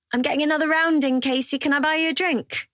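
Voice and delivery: Dino's voice. A narrator's reading. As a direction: call out the round over the pub noise.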